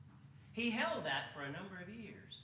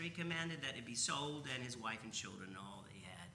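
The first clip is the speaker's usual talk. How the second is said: ordinary delivery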